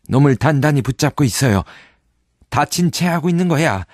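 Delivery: the navvy yells a warning; shouting navvy. Acted. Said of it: "인부가 소리쳤다".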